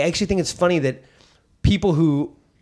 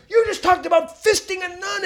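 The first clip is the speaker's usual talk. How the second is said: high, shocked outraged voice; mock outraged owner. Club owner accusing the comic, high scandalized voice. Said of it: "He goes".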